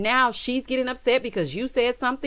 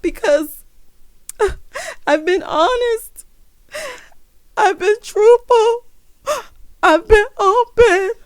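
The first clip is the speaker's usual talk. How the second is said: "I've been honest" wailed much higher and louder, slow and blubbering; mock boohoo crying; 11 semitones higher, 6 dB louder, slower than usual. Host mocks Erica crying in the restroom, hammed-up blubbering wail. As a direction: ham up the weepy sobbing. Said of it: "boohoo crying".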